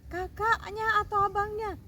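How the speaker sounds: a cross mom's sharp questioning; stern mother asking. Acted. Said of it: "Mamanya tanya". (ibu yang bertanya dengan suara tinggi dan keras)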